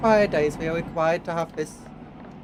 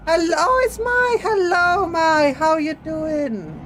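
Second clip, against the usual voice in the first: high, bouncy hello called out; squeaky high welcome, then a slow last question; bright greeting; 11 semitones higher, 6 dB louder, slower than usual. Real, not acted. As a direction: greet her like an excited fan. Cheery greeting to a streamer.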